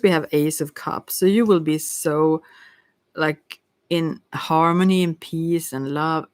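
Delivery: usual speaking voice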